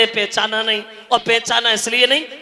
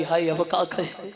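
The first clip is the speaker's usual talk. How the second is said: low, softening voice of private dismay; understated "oh dear" aside; worried aside; 6 semitones lower, 8 dB quieter than usual. Acted. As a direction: drop down into a quiet inner thought. Parvati's worried private thought, retold.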